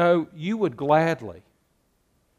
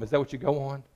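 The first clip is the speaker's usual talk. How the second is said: normal talking voice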